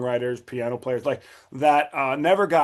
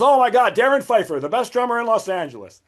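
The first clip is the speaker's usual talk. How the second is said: high, loud mock amazement; sarcastic awe; 9 semitones higher, 4 dB louder than usual. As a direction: imitate a mocking fanboy gush. Retold mocking of a rival's boast.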